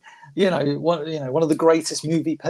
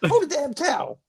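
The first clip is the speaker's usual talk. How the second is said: much higher, strained coach voice; Duke impression; 11 semitones higher than usual. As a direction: push it out like a cornerman. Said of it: "That's exactly how he says it".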